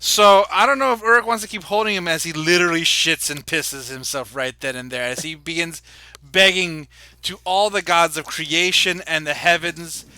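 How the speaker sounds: ordinary talk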